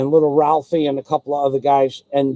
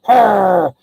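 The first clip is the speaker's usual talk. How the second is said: loud doggy growl; drawn-out dog growl; imitated dog growl; 3 semitones higher, 5 dB louder than usual. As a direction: snarl like a stubborn dog. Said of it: "he would, like, he would give".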